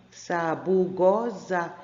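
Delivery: "Sabugosa" slowly divided into syllables. Shown as a demonstration. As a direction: draw out each syllable of "Sabugosa" up to the final za. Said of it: "É na última sílaba?".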